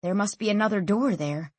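plain reading voice

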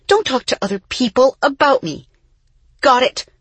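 controlled anger. A narrator's reading. Isa cutting off her twin, controlled anger building. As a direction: lay down each order deliberately, intensifying. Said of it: "Isa's voice lowered".

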